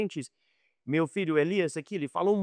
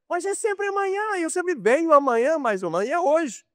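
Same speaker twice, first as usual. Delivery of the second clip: exasperated; 11 semitones higher than usual. Retold or imitated. Frustrated customer at the mechanic.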